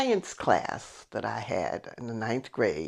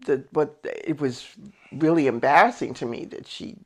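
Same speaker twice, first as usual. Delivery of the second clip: ordinary talk, nothing marked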